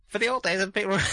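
sped-up high squeaky voice; chipmunk voice. Retold or imitated. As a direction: talk like a fast-forwarded tape. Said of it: "Gray said".

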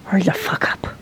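whisper. Real, not acted. Impatient aside while others talk.

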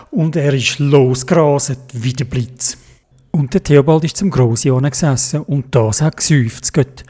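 usual speaking voice

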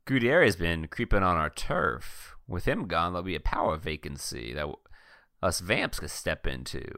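low, quiet scheming voice; conspiratorial vampire. Acted. Vampire pitches taking over the turf, low and quiet.

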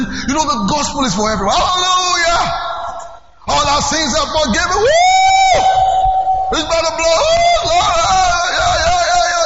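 exultant revival shouting; fiery preaching. Real, not acted. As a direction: whip the crowd up with joy. Preaching at full force.

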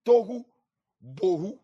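"tohu" and "bohu" said TOH-hoo BOH-hoo, Hebrew style; Hebrew pronunciation. Shown as a demonstration. Preacher teaching church the Hebrew words, carefully pronounced. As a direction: say "tohu bohu" as two rhyming Hebrew words, stress on first syllables. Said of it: "The Hebrew is".

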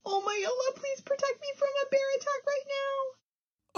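soft, high mumbled prayer; a scared beaver's hushed plea for protection; mumbled under her breath. A narrator's reading. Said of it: "and mumbled under her breath".

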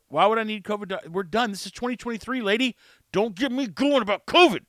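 worked-up imagined telling-off; indignant outburst. Retold or imitated. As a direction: protest in exasperation, punching up the ending.

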